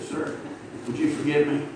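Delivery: soft, low humble apology; a touch of meek apology. Retold or imitated. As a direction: say it gently, asking forgiveness. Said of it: "I said".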